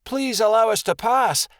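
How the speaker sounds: high, pleading cry; anxious high-pitched appeal; cowering plea. A narrator's reading. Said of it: "Midnight Star cried, cowering".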